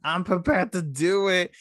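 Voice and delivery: high diva voice; Drag Race quote. Pretend. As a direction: answer like a reality-show queen. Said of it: "Drag Race references".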